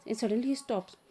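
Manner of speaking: ordinary talk, nothing marked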